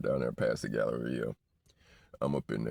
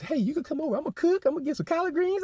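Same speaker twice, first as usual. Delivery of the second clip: high, put-on old lady voice inviting him over; 6 dB louder than usual. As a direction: play a club auntie coaxing a young man home. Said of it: "They be up in there pulling up on girls' boyfriends".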